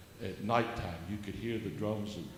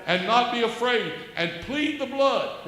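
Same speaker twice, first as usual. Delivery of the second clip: loud, higher preacher's proclamation; preaching at full force; 11 semitones higher, 11 dB louder than usual. Acted. Exhorting the host and viewers, loud high preaching. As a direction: proclaim it like from the pulpit.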